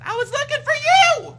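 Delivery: put-on a small kid explaining himself; little boy reply. Frill the boy answering his angry father. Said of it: "Frill answers".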